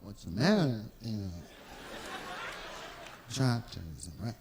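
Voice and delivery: deep low angry voice. Shown as a demonstration. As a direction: let the voice drop deep when mad. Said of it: "my voice gets lower and lower".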